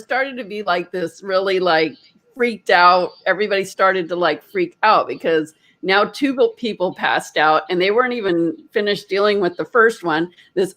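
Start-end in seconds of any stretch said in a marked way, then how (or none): none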